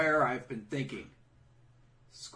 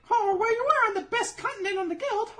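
very high, louder, laughing falsetto; mock girly voice; 9 dB louder than usual. Imitating a cutesy anime girl, high laughing falsetto. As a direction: squeak it out through giggles. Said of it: "she's like".

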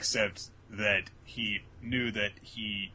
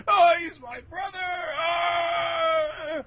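high, loud crying shout; exaggerated grief-stricken scream; mock anguished wail; 7 dB louder, much slower than usual. News of brother's death retold.

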